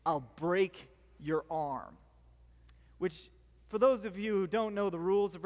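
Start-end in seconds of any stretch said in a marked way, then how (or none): 0.0-2.0 lower, slow, deliberate trash-talk threat; menacing threat. Retold or imitated. Pumped-up wrestler threatening his opponent before the final match, low and slow. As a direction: drag out each word like a tough guy sizing up his rival. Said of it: "he goes".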